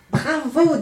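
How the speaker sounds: put-on high, excited little girl; enthusiastic cheer. A narrator's reading. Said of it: "dit la plus jeune enthousiasmée".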